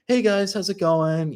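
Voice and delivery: lifted pitch, playing the live host; streamer greeting. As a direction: imitate greeting a stream audience.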